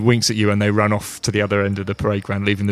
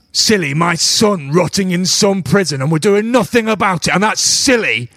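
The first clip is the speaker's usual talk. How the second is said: high, rising angry outburst; outraged; 10 semitones higher, 4 dB louder than usual. Acted. Father of a captured son rebuking a visitor, outraged. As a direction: throw the word back in indignant fury.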